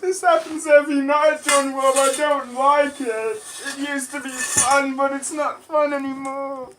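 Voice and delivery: high, loud hammed-up sobbing; mock crying. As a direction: wail like a wronged child.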